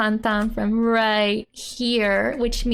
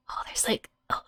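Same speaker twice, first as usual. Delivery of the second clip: whisper; 9 dB quieter, faster than usual. Pretend. Jokey secret adult talk.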